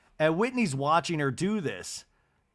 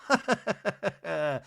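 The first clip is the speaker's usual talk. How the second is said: a mimicked comic laugh with a falling end; imitating the host's signature laugh; imitated laugh; 6 semitones higher than usual. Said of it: "she does her laugh".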